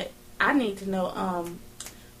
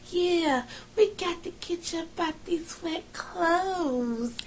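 slow, high, teasing purr; sly seductive quote; 6 semitones higher, 6 dB quieter, much slower than usual. Retold or imitated. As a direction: tease him slowly. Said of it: "she was like".

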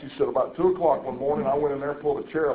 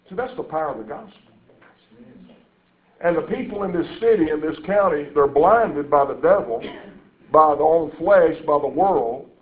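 ordinary delivery

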